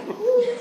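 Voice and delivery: high laughing whew of exasperation; exasperated whew. Real, not acted. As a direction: blow out a tired laughing whew. Exasperated exhale.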